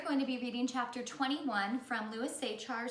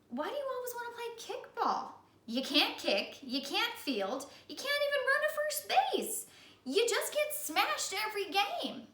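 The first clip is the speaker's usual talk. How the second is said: high, frustrated scolding; high exasperated kid voice; exasperated Louis; 12 semitones higher than usual. Acted. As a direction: list his faults in disbelief.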